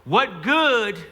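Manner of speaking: Ma's voice. Acted. Soldier keeps hearing Ma's voice, insistent mother. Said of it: "I keep hearing Ma's voice".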